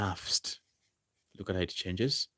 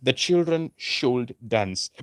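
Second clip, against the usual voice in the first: put-on The children drawn out slowly and raised, should dance dropping; slow model sentence; 5 semitones higher, 6 dB louder, slower than usual. Shown as a demonstration. Teacher slowly modelling the example for the class. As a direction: lay out the English sentence slowly. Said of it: "So you say".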